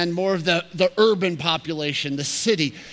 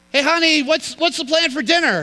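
calling out; 10 semitones higher, 6 dB louder than usual. Retold or imitated. Husband calling from next room.

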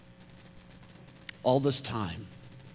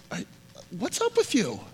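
incredulous; 11 semitones higher than usual. Retold or imitated. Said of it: "you'd immediately look at Jesus and be like".

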